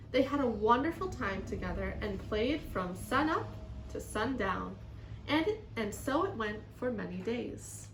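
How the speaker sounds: put-on lively, expressive children's story reading; story read-aloud. A narrator's reading. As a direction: read to children with strong emphasis.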